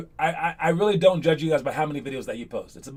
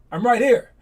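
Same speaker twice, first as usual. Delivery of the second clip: calling out; 6 semitones higher, 6 dB louder than usual. Retold or imitated. Calling out, announcing himself.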